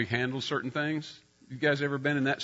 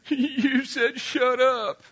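put-on higher, slow, hurt little-girl voice; daughter's reproach; 11 semitones higher, much slower than usual. Oldest daughter tearfully reproaching her dad, slow and hurt. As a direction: draw it out like a wounded child.

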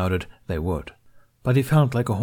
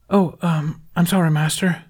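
higher, hesitant, embarrassed apology; 9 semitones higher, 4 dB louder than usual. A narrator's reading. Apprentice to the master he woke, embarrassed. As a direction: apologise awkwardly in a raised pitch. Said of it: "embarrassed to have roused his counterpart".

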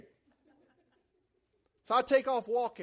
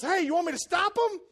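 calling out; 7 semitones higher, 6 dB louder than usual. Retold or imitated. Helpful driver calling out.

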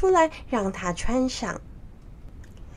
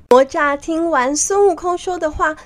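ordinary delivery, nothing marked

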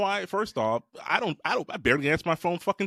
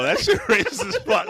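higher voice breaking into real laughter; laughing outrage; 5 semitones higher, 3 dB louder than usual. Real, not acted. Host reacting to a played accent clip, laughing outrage. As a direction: protest through the laughs.

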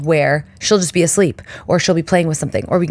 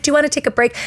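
much higher, gentle question; sweet hygienist; 9 semitones higher, much faster than usual. Retold or imitated. Hygienist offering the mother a break, much higher and gentle. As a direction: ask like a kind hygienist.

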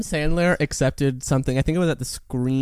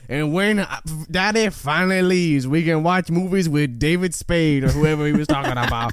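lightly raised Southern belle drawl; Southern debutante accent; 2 semitones higher than usual. Retold or imitated. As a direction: read it out as a mock Southern debutante. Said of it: "Please read in your Southern debutante accent".

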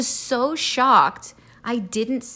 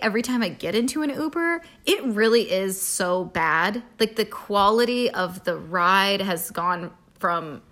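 usual speaking voice

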